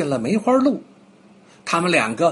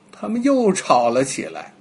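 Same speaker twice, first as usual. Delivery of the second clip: ordinary delivery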